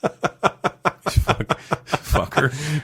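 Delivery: swearing through real laughter; breathless laughing curse. Real, not acted. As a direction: laugh out the words fuck, fucker. Laughing at his own nerves.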